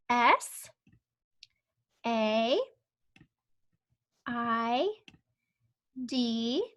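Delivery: letters spaced out one by one; spelling out letters. Shown as a demonstration. Teacher spelling a sight word while tracing, slow. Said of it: "we're gonna trace the word said".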